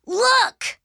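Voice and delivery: very high, loud exclamation; amazed. Acted. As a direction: call out a thrilling discovery. Arthur discovers puppies.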